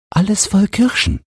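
very high, thin-air feverish murmur; feverish son fixated. A narrator's reading. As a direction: think it aloud as the sick boy. Sick boy's thought, much higher. (fiebriger Junge, ganz hoch)

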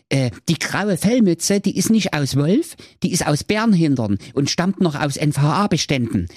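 hohe, eilige Ritterstimme mit viel Gehabe; hoch und flott, wie ein aufgeregter Prinz; Ritter Prinz Meisenherz. Acted. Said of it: "fuhr Ritter Prinz Meisenherz fort". (hohe, aufgekratzte Ritterstimme beim Bericht an den Kommissar)

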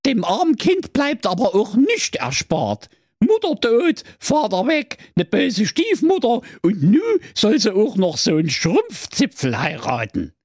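put-on high, pitying old turtle voice; a know-it-all turtle lamenting the poor child; oracle turtle. (hohe, mitleidige Schildkrötenstimme)

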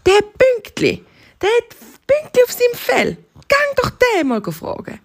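high, emphatic zebra voice; excited zebra. Acted. Zebra sends hare to the cheetah, high and eager.

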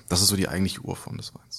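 soft unvoiced whisper. Real, not acted. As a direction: drop to a whisper. Quiet aside about orange wines.

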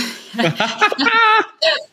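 high, loud laugh; burst of laughter. Real, not acted. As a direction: laugh out at your own line. Laughs at own punchline.

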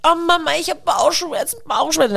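high theatrical whine of tummy pain; child faking a bellyache, high and dramatic; hammed-up sick kid. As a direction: overact the stomach ache like a Hollywood star. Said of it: "krümmte sich wie so ein Hollywood-Schauspieler".

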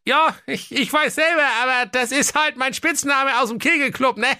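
very high, sheepish king; squeaky, bashful ruler; embarrassed king voice. Acted. (hohe verlegene Königsstimme)

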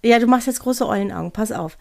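usual speaking voice

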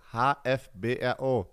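slow, spelled letter by letter; dictating. Shown as a demonstration. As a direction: spell out each letter clearly for the listener. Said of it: "Alles groß geschrieben". (langsam diktiert, Buchstabe für Buchstabe)

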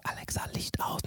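soft unvoiced whisper. Shown as a demonstration. Showing the whisper skill so a sleeper stays asleep, whispered. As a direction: whisper so no one wakes. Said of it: "wenn du flüsterst".

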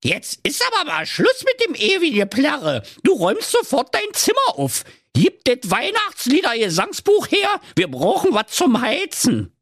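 very high-pitched, nagging mum; scolding mother. A narrator's reading. Tipsy mother tells her singing son off, scolding. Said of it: "Und die rief".

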